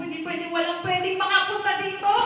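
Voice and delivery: loud, high panicked protest; acted desperate refusal. Man sealing off his shameful records, desperate. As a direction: forbid it loudly, pitch pushed up. Acts desperate hiding of secrets.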